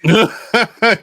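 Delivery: big open laugh; loud laugh. Real, not acted. Laughs at podcast chat.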